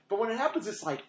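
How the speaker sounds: ordinary talk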